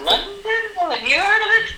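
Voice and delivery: squeaky high fake Brit; high mock British. Fake Brit dodging a question, in a high smug voice.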